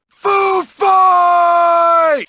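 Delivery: high, long-held shout; rallying yell. Acted. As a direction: holler it across a crowd. Bully calls a food fight.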